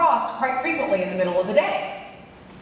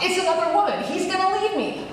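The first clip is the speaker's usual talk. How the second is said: panicked thought; 6 semitones higher than usual. Retold or imitated. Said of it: "My heart was beating a million miles a minute".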